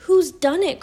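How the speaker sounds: high, loud upset question; distressed cry. A narrator's reading. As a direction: cry it out in dismay. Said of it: "cried Susan".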